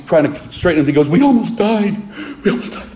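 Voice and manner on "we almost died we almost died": high, panicked sobbing wail that breaks up; mock crying. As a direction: imitate a terrified man blubbering. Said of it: "So while he's crying".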